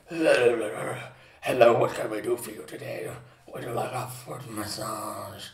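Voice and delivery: exaggerated low, slowing voice thick with a cold; deep cold-ridden voice. Greeting bank customers with a cold, deep voice. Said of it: "one day I had a cold".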